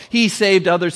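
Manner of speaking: mocking. Chief priests among themselves, loud mockery. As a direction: sneer it out loudly. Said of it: "mocked him amongst themselves".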